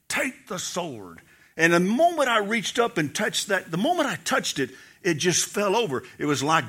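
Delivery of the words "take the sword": softer and slow, a grave command; hushed divine command. Retold or imitated. The Lord repeats his command.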